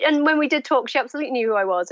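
usual speaking voice

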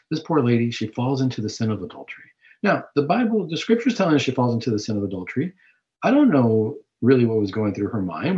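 ordinary talk

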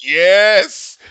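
loud, high, drawn-out yes; excited cheer. Retold or imitated. Fan cheering Worf's line to Picard, excited. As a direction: cheer it out at full voice. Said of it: "I was like".

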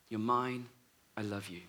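tender voice dying away to a hush; fading tender voice. Retold or imitated. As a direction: let it fade almost to nothing. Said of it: "the phrase that she heard the voice saying".

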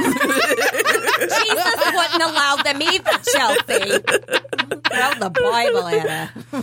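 slow, drawn-out teasing preacher; joking Bible-thumper voice; mock preacher accent. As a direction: lay on a preachy drawl in jest.

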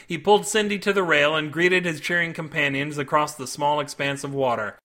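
ordinary delivery

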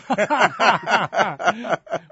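a burst of high, loud real laughter; big laugh. Real, not acted. Guest laughing at how his pastor set him up, loud and high. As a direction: laugh out loud at your own story. Laughs after "hots for you" story.

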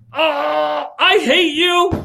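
put-on high, drawn-out childish outburst; acted angry yell. Teddy at Nancy in the middle of church, yelling. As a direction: wail it out loud like a fed-up boy. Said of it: "Teddy yells out".

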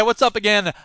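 ordinary talk

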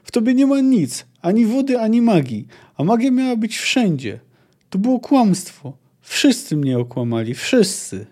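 angry outcry. A narrator's reading. Ciri shouting at the desert that everyone lied. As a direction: keep shouting, betrayed and angry. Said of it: "krzyknęła".

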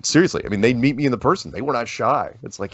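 ordinary delivery

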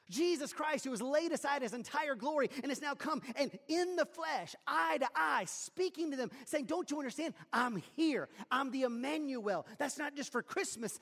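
high, loud, rising sermon delivery; preaching at full force. Acted. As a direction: preach it out with mounting fire.

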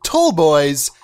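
slow, drawn-out podcast opener; hammed-up welcome to Big Tall Boys; show host intro.

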